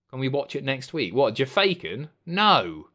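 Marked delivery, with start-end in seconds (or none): none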